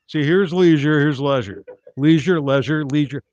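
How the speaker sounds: leisure said as LEE-zhur, loud and lofty; posh leisure. Shown as a demonstration. Comic shows the crowd the rich man's leisure, loud and lofty. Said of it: "higher up than leisure".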